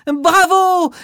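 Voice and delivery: high, loud bravo; cheering. Acted. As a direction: cheer for the dancer. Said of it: "crient les enfants".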